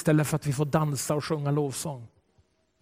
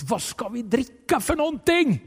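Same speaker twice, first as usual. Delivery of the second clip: the thirsty people crying out to Moses; 7 semitones higher than usual. Acted. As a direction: call out in protest to Moses. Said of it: "folket skriker ut".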